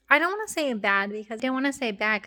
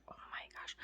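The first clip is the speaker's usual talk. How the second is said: whisper; 22 dB quieter than usual. Retold or imitated. Sore while moving daughter.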